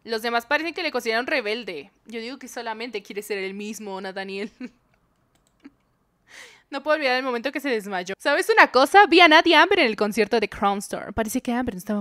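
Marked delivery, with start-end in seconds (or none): none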